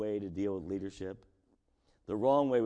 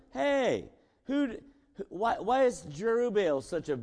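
indignant Abimelech; 9 semitones higher, 4 dB louder than usual. Retold or imitated. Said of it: "Abimelech said".

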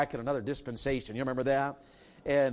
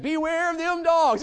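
much higher, louder, slow ringing shout; preacher's loud punchline; 13 semitones higher, 7 dB louder than usual. Retold or imitated. Imitating the Detroit preacher, slow high shout of the title. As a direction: hit the sermon title hard, drawn out. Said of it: "he just, he said".

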